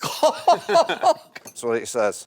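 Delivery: chuckling through the words; laughing. Real, not acted. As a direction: laugh while reading it out. Reading out a joke question.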